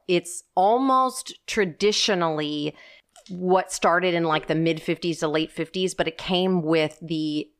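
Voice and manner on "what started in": normal talking voice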